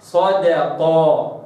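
صدقة slow, light dal beside sad; tajweed recitation. Shown as a demonstration. Teacher models صدقة slowly. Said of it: "هكذا".

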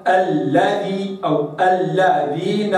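"الذي" and "الذين" slow, the shadda on the lam split clearly; doubled lam. Shown as a demonstration. Teacher reciting "الذين" with the doubled lam drawn out. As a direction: separate the two lams in "الذي". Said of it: "هكذا".

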